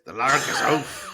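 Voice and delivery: put-on slow, drawn-out Scottish accent. Showing co-host how it goes, slow Scottish accent. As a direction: draw it out in Scottish. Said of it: "That's it".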